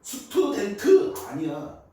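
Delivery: 스튜던트 slow and loud, each syllable hit; stressed slow 스튜던트. Shown as a demonstration. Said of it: "트가 아니야".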